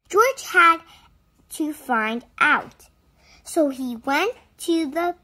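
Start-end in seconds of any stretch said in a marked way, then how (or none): none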